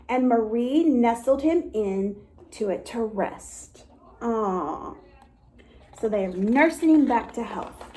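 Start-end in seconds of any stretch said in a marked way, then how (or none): 4.2-4.9 tender aw. A narrator's reading. Tender aside.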